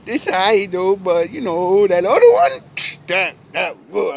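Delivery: high, slow, whiny critic voice; honest listeners. Retold or imitated. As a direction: act out the unimpressed crowd, high and drawn out. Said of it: "majority of people say".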